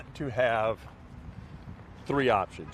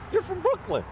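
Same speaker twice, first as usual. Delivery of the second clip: mock Brooklyn accusation. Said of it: "They go".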